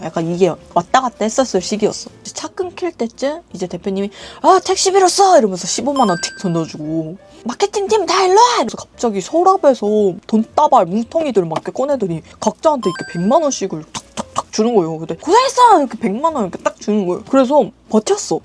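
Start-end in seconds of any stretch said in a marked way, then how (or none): 4.4-5.4 high, loud imitated order; raised mock-boss voice; brusque boss command. Boss brusquely tossing taxi money. 7.4-8.5 high, loud imitated summons; raised mock-boss shout; boss calling out. Boss summons the marketing team. 15.1-15.8 high, punchy imitated shout; boss's loud praise. Boss handing out cash.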